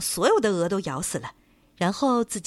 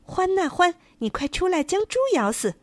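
calling the badger; 10 semitones higher than usual. A narrator's reading. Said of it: "公鸡又对獾说".

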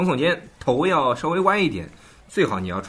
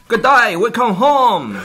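high, loud banner greeting; big welcoming call; 10 semitones higher, 7 dB louder, slower than usual. Retold or imitated. Telling of the banner at Sydney arrivals, big mock welcome. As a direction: call it out grandly.

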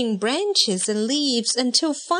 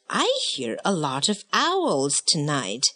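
lightly voiced dad, lower; a hint of a father's low voice; Dad's remark; 8 semitones lower than usual. A narrator's reading. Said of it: "remarked Dad".

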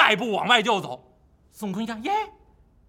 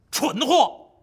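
scolding snap; 4 semitones higher, 4 dB louder than usual. Acted. Wukong scolds Pig.

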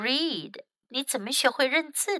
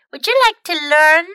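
put-on high, louder child's offer; eager little girls; 6 semitones higher, 9 dB louder than usual. Millie and Molly offer to teach him, high eager offer. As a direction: offer it brightly as an eager girl.

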